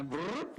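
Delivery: high rising imitated zoom noise; jet whoosh sound. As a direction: make the jet zip across the screen. Imitating jet on radar screen.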